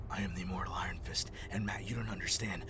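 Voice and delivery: hushed, overly solemn hero whisper; mocking Iron Fist. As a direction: whisper it like a self-serious brooding hero. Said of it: "why does he talk like that".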